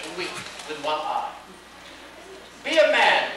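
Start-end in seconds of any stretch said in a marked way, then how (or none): 2.6-3.3 loud, higher angry cry; the Bellman crying out in wrath at the Baker; wrathful cry. Acted. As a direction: bark it at a coward in wrath. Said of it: "cried the bellman in wrath".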